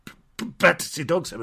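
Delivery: Blimey stammering on the B of Battersea; a mild retold stutter on B-, B-, Battersea; stuttered line. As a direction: catch and repeat the B of B-, B-, Battersea. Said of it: "he can only just get it out".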